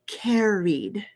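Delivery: "carried" with a long, clear ee before the d. Shown as a demonstration. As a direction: stretch "carried" as CARE-eed. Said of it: "say the long E sound".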